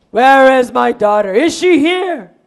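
loud, demanding voice; angry father. Acted. Father arrives looking for his daughter, angry demand. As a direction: boom out the demand.

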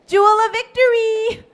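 high-pitched, lilting name call. Acted. Amy calls the girl by her long name, calling out. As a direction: sing out the name to summon a child.

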